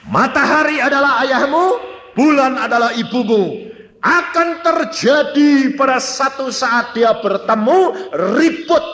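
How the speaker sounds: loud, high prophetic declaration; retold divine proclamation. God revealing a dream's meaning to a child, loud proclamation. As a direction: proclaim it loudly, pitched high.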